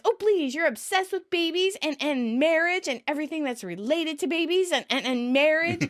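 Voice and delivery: high, stammering exasperation; exasperated cry. Retold or imitated. Chandler accusing Monica of baby obsession, exasperated cry. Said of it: "Chandler cries".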